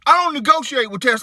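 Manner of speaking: high, loud comic outburst; exasperated dad. Acted. Dad refusing his little girl's demands, exasperated. Mock telling-off of daughter.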